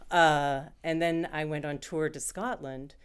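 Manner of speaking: usual speaking voice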